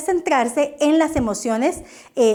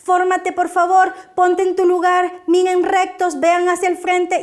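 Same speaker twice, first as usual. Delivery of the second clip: nagging scolding; 6 semitones higher than usual. Shown as a demonstration. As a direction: nag the children into line. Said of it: "estoy todo el tiempo como regañando".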